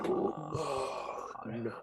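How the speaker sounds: slow, breathy whispered name; whispering. Acted. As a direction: whisper it slowly, full of awe.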